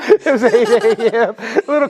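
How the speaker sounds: words through laughter; laughing. Real, not acted. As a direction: giggle as you say it. Laughing about drinking before call.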